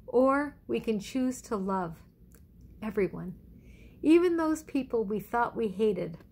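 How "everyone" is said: normal talking voice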